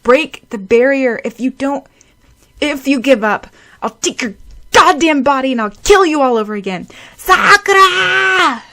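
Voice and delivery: loud, high screaming with a breaking voice; cracked desperate scream. Acted. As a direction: scream down through the ice to reach her. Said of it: "screamed in a cracked voice".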